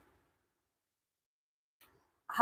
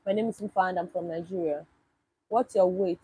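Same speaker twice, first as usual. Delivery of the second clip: usual speaking voice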